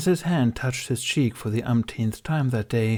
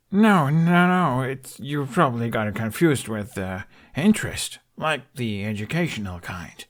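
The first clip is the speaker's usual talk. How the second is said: flustered; 4 semitones higher, 4 dB louder than usual. A narrator's reading. Flustered denial about scent.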